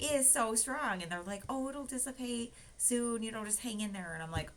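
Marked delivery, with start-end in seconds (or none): 0.0-1.0 exclaiming. Retold or imitated. Complaining about antiseptic smell. 1.5-4.1 calm the patient down; reassuring nurse. Retold or imitated.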